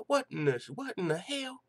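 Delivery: high squeaky what in the hell; disbelief. Real, not acted. Host reacting to the punch claim, high disbelieving squeal. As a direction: pitch leaps up in shock.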